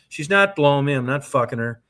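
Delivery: normal talking voice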